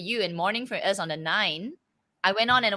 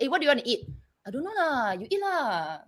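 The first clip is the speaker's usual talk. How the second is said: higher Singaporean lilt with "lah" endings; 4 semitones higher, 4 dB quieter than usual. Shown as a demonstration. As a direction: play the Singaporean side. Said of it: "I have to do the Singaporean side".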